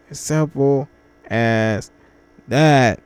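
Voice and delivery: normal talking voice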